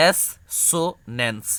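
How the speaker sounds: এস সো ন্যান্স as three separate parts; syllable by syllable. Shown as a demonstration. Teacher building assonance from syllables. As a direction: put the pieces of the word back together. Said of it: "এভাবে ভেঙে ভেঙে পড়বা".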